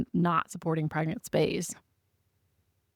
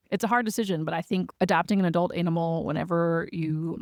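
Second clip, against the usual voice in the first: ordinary talk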